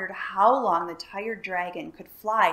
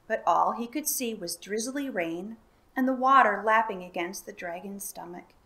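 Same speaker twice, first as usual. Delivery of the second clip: plain reading voice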